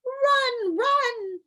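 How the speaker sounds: very high, loud far-off call; crying out. Acted. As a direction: call out a high urgent warning. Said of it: "a voice cried out".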